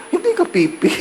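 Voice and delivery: high amused disbelief through a laugh; laughing surprise. Retold or imitated. Bus driver realizing priest can talk, laughing. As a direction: laugh it out, caught off guard.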